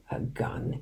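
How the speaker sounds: scornful, breathy hush; a voiceless scoff at the idea; disgusted whisper. A narrator's reading. Father scoffing at Danny's question, disgusted whisper. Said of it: "he cried, disgusted".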